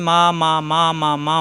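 six even beats of "মা"; short vowel on each "মা"; quick short syllables. Shown as a demonstration. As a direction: rattle off "মা" six times in steady rhythm. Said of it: "এই উচ্চারণ করতে যতক্ষণ সময় লাগছে".

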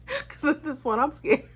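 high, talking through a laugh. Real, not acted. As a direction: laugh it off mid-sentence. Laughs while retelling fear.

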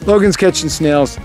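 ordinary delivery

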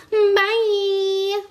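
high, held sign-off; drawn-out high bye. Real, not acted. Signing off to the fans, high and drawn out. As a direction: stretch the goodbye out high.

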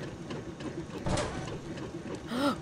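long audible intake of breath; drawn-out gasp of discovery; excited gasp. Real, not acted. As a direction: gasp at a sudden find. Spots binoculars in the game.

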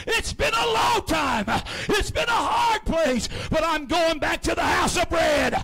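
loud, high, shouting preacher's climax; preaching at full force. Acted. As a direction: preach it out hard, lifting the whole room.

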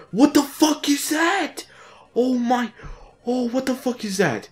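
very high, drawn-out squawk; shocked outrage. Real, not acted. Player seeing something absurd in the game, shrieking disbelief. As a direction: pitch leaps way up in real disbelief.

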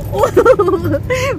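high rising giggle; laugh. Real, not acted. Riding in the truck with everyone, high giggle.

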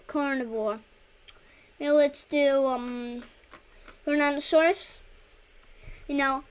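ordinary delivery

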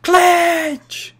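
high, loud excited yelling at the screen; hype shout. Real, not acted. Streamer yelling to his UFC fighter, loud excited shout. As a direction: cheer the fighter on at full volume.

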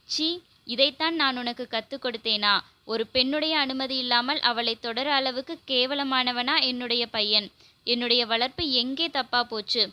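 plain reading voice

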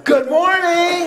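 big, high, drawn-out call to the room; high-pitched hearty shout to rouse a sleepy crowd; rousing greeting. Real, not acted. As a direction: belt out a loud wake-up greeting, stretched long. Louder greeting after sleepy response.

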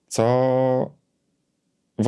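ordinary delivery

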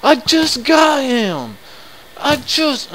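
high, loud, dragged-out wail of loss; real anguished scream. Real, not acted. Player screaming as his new cat dies, full anguished yell. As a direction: scream it as the prize slips away.

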